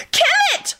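piercingly high scream. A narrator's reading. Rosie wants the skull killed, screaming. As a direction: scream it at the very top of your range. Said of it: "Rosie screamed".

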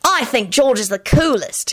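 put-on high boyish declaration; announcing. A narrator's reading. Timothy announces his hero to Alex, declaring. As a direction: declare it like an admiring child. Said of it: "he announced".

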